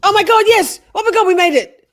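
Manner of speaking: loud, high cry of relief; relieved laughter. Real, not acted. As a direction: shout it out, thrilled. Relief when the witness is done.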